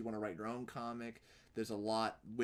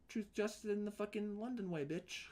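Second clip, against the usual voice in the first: high-pitched mock Londoner; lightly hammed-up hero slogan, pitched up for London; Superman; 9 semitones higher than usual. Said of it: "when he shows up in London".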